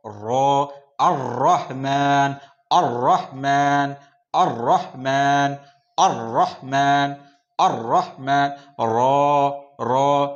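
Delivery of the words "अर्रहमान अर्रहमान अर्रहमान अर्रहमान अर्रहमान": अर्रहमान with a rolled, doubled r; Arabic ra sound. Shown as a demonstration. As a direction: say अर्रहमान rolling the रर hard. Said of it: "इस तरह से बोलना है".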